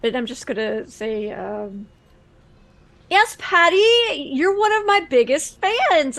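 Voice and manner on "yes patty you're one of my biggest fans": lightly voiced, higher streamer; slightly put-on sweetness for a fan; gushing online star. Streamer presenting a gift to a young fan, lightly gushing.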